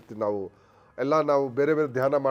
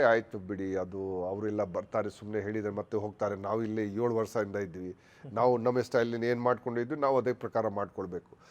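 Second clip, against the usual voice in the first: lower, softer offhand brush-off; dismissive trainee; 7 semitones lower, 7 dB quieter than usual. Retold or imitated. Officer voicing complacent trainees, low soft dismissive tone. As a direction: shrug it off like a set-in-his-ways trainee.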